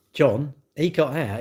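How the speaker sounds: ordinary talk, nothing marked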